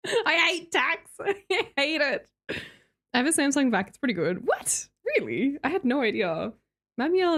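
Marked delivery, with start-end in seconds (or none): 0.0-2.3 laughing it out slowly; slow laugh through the words; real laugh while groaning about tax. Real, not acted.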